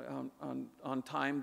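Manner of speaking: normal talking voice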